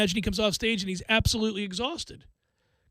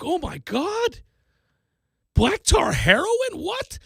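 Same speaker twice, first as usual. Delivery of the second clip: high, incredulous reenacted gasp of a reader; mock shock; 9 semitones higher, slower than usual. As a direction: climb to a squeaky, baffled question. Reenacting shock while reading the book.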